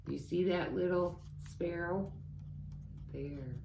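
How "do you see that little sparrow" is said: usual speaking voice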